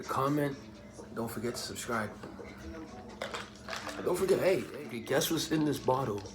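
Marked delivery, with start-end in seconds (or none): none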